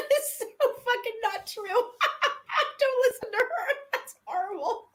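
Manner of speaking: high, laughing protest; real laughing disbelief. Real, not acted. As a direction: squeal it through laughter. Laughing protest at a gross joke.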